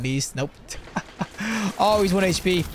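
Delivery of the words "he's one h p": ordinary delivery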